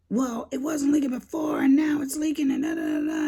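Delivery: imitating the husband getting mad and loud. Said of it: "he was like, mad".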